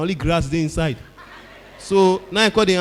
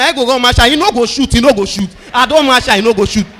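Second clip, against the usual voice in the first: loud cocky taunt, high; shouted defiant chant; bold kid's shout; 4 semitones higher, 9 dB louder than usual. Retold or imitated. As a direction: brave child daring Father Christmas. Fearless kid taunting Santa.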